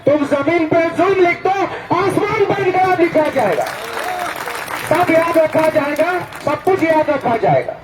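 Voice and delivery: rhythmic, declaiming protest poem, refrains repeated; poem recitation. Acted. (जोशीले अंदाज़ में कविता पाठ)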